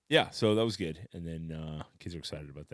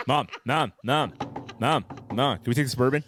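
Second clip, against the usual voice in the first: higher, louder whiny nagging; nagging kid voice; 6 semitones higher, 7 dB louder than usual. Retold or imitated. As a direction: pester mom like a kid. Imitating son nagging mom.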